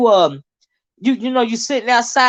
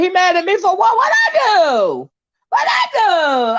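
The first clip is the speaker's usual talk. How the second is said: indignant disbelief; 9 semitones higher than usual. Real, not acted. Host shouting back at an angry viewer in mock outrage. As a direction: protest your innocence, amused.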